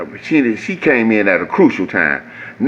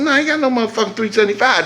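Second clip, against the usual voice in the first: high, fast indignant protest; indignant refusal; 10 semitones higher, faster than usual. Retold or imitated. Refusing the mechanic's inflated price, high and indignant. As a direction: fire it back at him.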